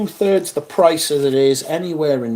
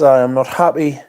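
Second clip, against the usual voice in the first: usual speaking voice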